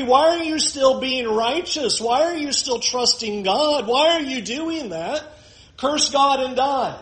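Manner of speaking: a mock bitter challenge from a wife; Job's wife taunting. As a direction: needle him for trusting God. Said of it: "Job's wife asked the question to Job".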